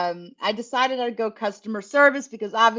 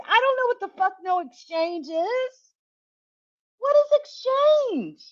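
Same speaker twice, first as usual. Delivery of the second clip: much higher, squeaky disbelief, slowed on the end; baffled outrage; 10 semitones higher than usual. Retold or imitated. Demanding her boss Ray explain the exchange team, shrill with disbelief. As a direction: voice cracking up high in total confusion.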